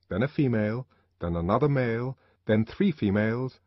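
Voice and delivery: usual speaking voice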